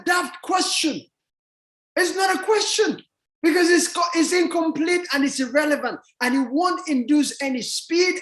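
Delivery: high-pitched, exclaiming argument; exasperated rant. Acted. Telling people their question is daft, exasperated.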